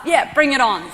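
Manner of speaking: hammed-up bring it on; mock challenge. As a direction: throw down the gauntlet, half joking. Retelling her bravado.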